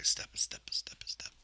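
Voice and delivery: soft whisper mimicking the fighter's steps; breathy whispered "step, step" rhythm; whispered step count. Shown as a demonstration. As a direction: whisper "step, step" like a light footfall. Imitating how horses step.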